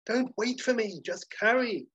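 high-pitched impatient instruction; exasperated order. Acted. Hotelier instructing the confused waiter on carrying, high impatient order.

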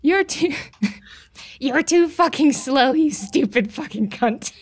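slow, drawn-out taunt; mock insulting grandma. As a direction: savour each insult slowly, as the ghost grandma. Voices the ghost grandma's insult.

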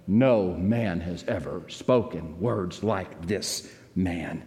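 low, soft and slow; hushed reverence. Real, not acted. Preacher telling the congregation, hushed and reverent. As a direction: let the words land reverently. Preacher echoes awed words.

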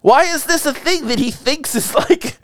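high, disbelieving question; incredulous. Retold or imitated. Friends mocking Bateman's excuse, high incredulous. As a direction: pitch up in total disbelief. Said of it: "And you're like".

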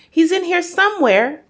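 high, loud, urgent; excited cry. A narrator's reading. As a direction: alert the others to an intruder. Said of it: "Matilda cried".

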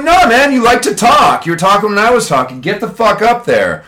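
high, loud teacher telling off; scolding a mouthy class; acted scolding. Teacher scolding disruptive kids in story.

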